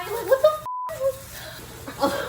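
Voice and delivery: squeaks up very high in disbelief; a real high-pitched shriek of shock. Real, not acted. Sees giant frog.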